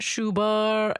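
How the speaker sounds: "Schubert" as shoo-BUR, silent t; wrong pronunciation shown. Shown as a demonstration. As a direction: say "Schubert" slowly with sh and no final t. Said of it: "It's not Schubert".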